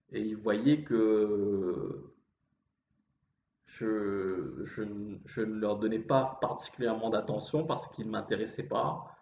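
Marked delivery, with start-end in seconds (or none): none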